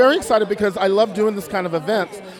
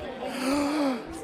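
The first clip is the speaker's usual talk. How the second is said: excited gasp; 8 semitones higher, 8 dB quieter than usual. Real, not acted. Sees guest arrive.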